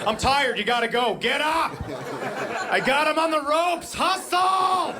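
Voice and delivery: pep-talk a sleepy sibling like a coach; kid hustling sibling. Acted. Kid waking sibling, acted quote.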